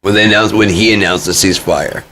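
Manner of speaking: normal talking voice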